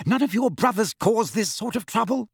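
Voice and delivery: high-pitched angry scolding; raging. A narrator's reading. Missus Weasley comparing the twins to their brothers, raging. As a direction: rage in a high mother's voice. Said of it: "Missus Weasley raged at the twins".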